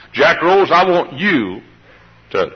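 usual speaking voice

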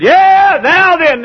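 high, loud, drawn-out jeer; acted derisive taunt; mocking scoffers. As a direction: jeer like a crowd of doubters. Mimics laughing scoffers.

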